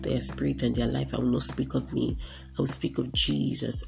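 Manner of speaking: usual speaking voice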